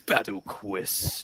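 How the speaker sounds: deep, slow, dramatic trailer read; movie trailer announcer voice. Acted. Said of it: "the movie announcer voice".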